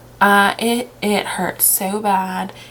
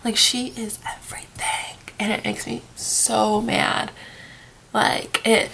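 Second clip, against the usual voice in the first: tearful whisper; 2 semitones higher, 4 dB quieter, slower than usual. Real, not acted. Upset fan, close to tears.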